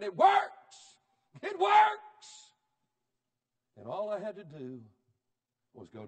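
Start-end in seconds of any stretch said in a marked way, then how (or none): 0.0-2.5 high, very loud triumphant shout; healed leper shouting for joy; joyful shout. Retold or imitated. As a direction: shout it out at the river's edge. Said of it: "I'd have shouted".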